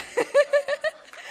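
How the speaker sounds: high, loud, near-tearful laugh; laughing hard. Real, not acted. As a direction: laugh until it almost cracks. Laughing through embarrassment.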